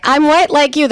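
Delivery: high-pitched, eager declaration; eager announcement. Acted. Imagined line to the family.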